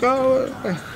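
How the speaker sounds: mock angry grunt. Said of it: "He's like".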